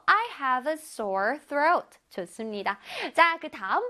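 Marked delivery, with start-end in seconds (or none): 0.1-2.0 very slow, careful English; slow teacher demo; slow model reading. Shown as a demonstration. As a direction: read it out slowly for the class. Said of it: "목이 따가워요".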